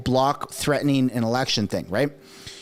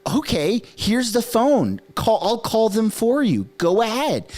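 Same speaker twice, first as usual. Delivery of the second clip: higher-pitched, drawn-out mock willingness; unbothered dare; 7 semitones higher than usual. Innocent person offering the phone to call cops, breezy dare. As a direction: call their bluff, all obliging.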